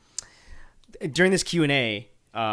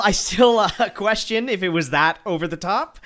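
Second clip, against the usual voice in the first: laughing; 9 semitones higher, 6 dB louder than usual. Real, not acted. Laughing while doubting Lithgow.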